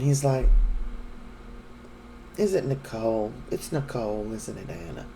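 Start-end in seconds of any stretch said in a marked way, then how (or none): 2.4-5.1 low, soft knowing question; imitated hushed, lower-voiced guess; quiet probing. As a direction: coax the secret out very softly. Retold Tony gently coaxes out secret.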